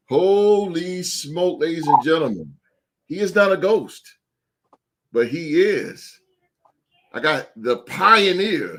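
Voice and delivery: ring-announcer style introduction with big punched words; hyped emcee with dramatic pauses; hype announcer. Acted. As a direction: build up a champion's entrance, drawing out key words. Host hypes returning co-host's introduction.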